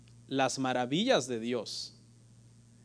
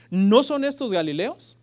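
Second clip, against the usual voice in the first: amazed question; 5 semitones higher, 5 dB louder than usual. Retold or imitated. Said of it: "se preguntan ellos".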